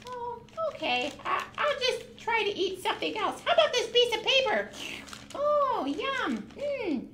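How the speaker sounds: high, fast, playful goat puppet voice. Acted. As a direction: chatter like a greedy little goat.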